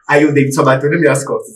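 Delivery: usual speaking voice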